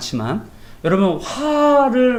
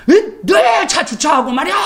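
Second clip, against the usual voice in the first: imitated angry shout; 9 semitones higher than usual. Driver demands why the car is parked, angry shout. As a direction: mimic an irate driver yelling. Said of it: "화가 난 거예요".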